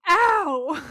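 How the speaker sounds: loud, high mock "Ow!"; hammed-up indignant cry of pain; outraged yelp. Gary reacting to Dean's punch, mock outraged yelp. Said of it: "Like, he's outraged".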